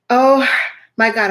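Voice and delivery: startled gasp. Retold or imitated. Sudden back pain in elevator.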